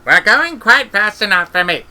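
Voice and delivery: put-on high, loud crotchety old woman; testy old witch. Granny retorting to Esk on the broom, loud and testy. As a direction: snap back like a nervous old witch.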